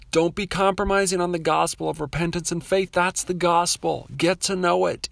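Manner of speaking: rally the flock to stand firm; urging the congregation not to be afraid, preaching; preaching exhortation. Acted.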